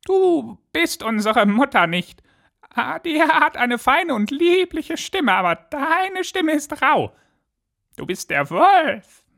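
very high, put-on kid voice; squeaky-high little goats, accusing; defiant goat kids. A narrator's reading. Goat kids tell the wolf he is found out, defiant squeaky voice.

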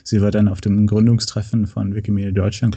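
ordinary talk, nothing marked

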